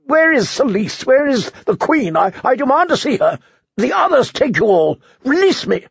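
high, fast, frightened demands; frantic outcry. A narrator's reading. Prisoner crying out to the guards, frantic and high. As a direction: demand it in panic. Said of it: "his outcries".

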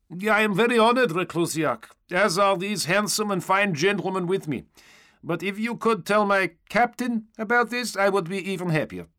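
high, buoyant trooper voice; cheerful honoured stormtrooper. A narrator's reading. Stormtrooper thanking the knight, high cheerful voice. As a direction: play it high and cheekily gracious.

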